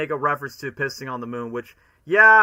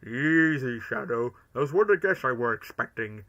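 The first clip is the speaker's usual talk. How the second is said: Grinch-like Gerald voice; 2 semitones lower than usual. Retold or imitated. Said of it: "there were some lines where he goes".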